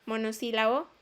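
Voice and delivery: ordinary talk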